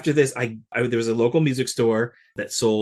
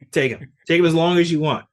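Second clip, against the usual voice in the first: higher and louder, mock-eager parents; imitating parents eager to let him go; 6 semitones higher, 6 dB louder than usual. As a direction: play the parents happily waving him off. Parents eagerly handing him over.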